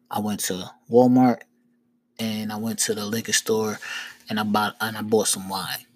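low, deliberate, with long pauses; measured explanation. Retold or imitated. Man retracing his errands for a cop, measured. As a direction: lay out the facts quietly, one by one.

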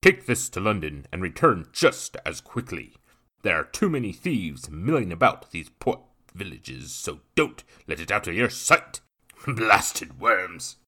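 loud, brash commanding voice; man in burgundy ordering the courier, brash; brash gentleman. A narrator's reading. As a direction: bark orders like a haughty gent. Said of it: "a brash voice stirred his attention".

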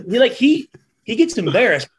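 usual speaking voice

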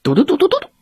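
high, rapid tooting; imitated horn toots. A narrator's reading. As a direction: toot like a little toy horn. Horn tooted behind the wolf.

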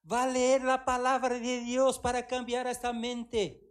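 slow emphatic commanding call; divine command. Retold or imitated. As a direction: lay down the order like God. God's command, slow and loud.

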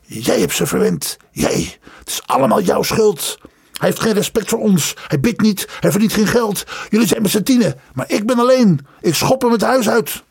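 rising to high angry shouts; furious, close to tears; tearful fury. Acted. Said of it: "van woede sprongen hem zelfs tranen".

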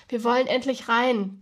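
ordinary delivery

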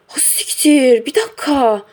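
the penny drops all at once; alarmed oh-no moment, voice jumping up; sudden realization. Retold or imitated.

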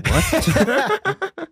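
high, laughing "what?"; baffled squeak through a laugh; confused teacher. Retold or imitated. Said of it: "he'd be like".

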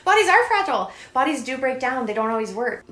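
high, squeaky mock of the old belief. Mocking her old self in a squeaky voice. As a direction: send up the old fear in a silly high voice.

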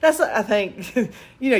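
ordinary delivery

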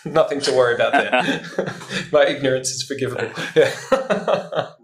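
self-mocking amused chuckle; laughing. Real, not acted. Self-mocking laughter about ignorance.

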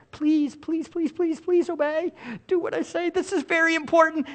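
high-pitched, soft begging; pleading parent. Acted. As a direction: plead in a small high voice, repeating urgently. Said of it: "Let me say it again".

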